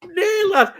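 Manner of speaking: high, loud no lad; mock protest. Defending Northern shows to co-host, high mock protest. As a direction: cry out in mock indignation.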